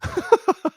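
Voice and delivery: squealing high laugh. Real, not acted. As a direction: burst into a shrill-high giggle. Laugh after joke.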